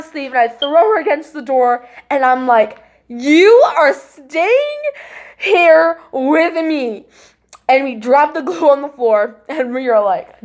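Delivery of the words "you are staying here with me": very slow, high, drawn-out order; raised pitch, dragging the words out; emphatic retold command. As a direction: stretch every word like an acted-out command. Retelling grabbing cousin in panic.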